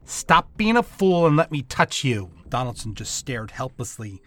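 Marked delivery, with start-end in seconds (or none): 0.0-2.3 louder, higher, impatient command; a little growl. Acted. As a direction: snap at him to hold still. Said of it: "She growled a little".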